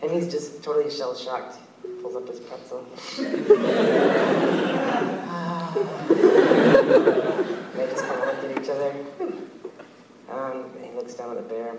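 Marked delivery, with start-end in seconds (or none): 2.9-7.7 high breathy giggle; giggling. Real, not acted. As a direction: burst out giggling. Laughing at own story.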